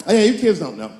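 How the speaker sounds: ordinary talk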